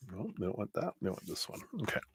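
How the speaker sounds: talking while crunching a cough drop; quiet mumble through a crunched lozenge. Real, not acted. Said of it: "Sorry, I'm crunching my cough drop".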